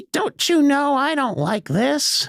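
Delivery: put-on high, slow, whiny kid voice; whiny child. Boy whines at his dad about the dinner. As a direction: pout it out like a fussy child. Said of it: "And I said".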